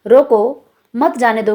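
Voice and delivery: "रोको" firm, pause, then "मत जाने दो"; comma after रोको. Shown as a demonstration. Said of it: "के बाद अल्प विराम".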